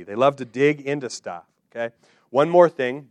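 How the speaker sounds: usual speaking voice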